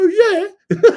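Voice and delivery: high, loud comic yelp breaking into laughter; mock Northerner reply. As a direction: squeal it back, laughing. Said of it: "when Northerners try to do my accent".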